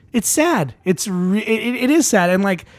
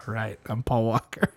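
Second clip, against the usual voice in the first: low, very soft undertone; quiet mock resolve; 7 semitones lower, 10 dB quieter than usual. Fan imagining himself as Paul Walker, quietly. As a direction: say it low and under your breath.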